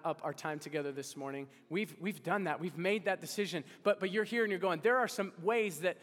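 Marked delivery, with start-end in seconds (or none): 3.8-6.0 loud, higher impassioned preaching; full-voiced pulpit climax; preaching at full force. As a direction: drive the appeal home with fire. Climax of sermon appeal.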